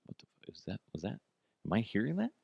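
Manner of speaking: whispered disbelief; hushed. Retold or imitated. Father woken at night, hushed and confused. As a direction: breathe it out, unsure what you heard. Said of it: "I'm like".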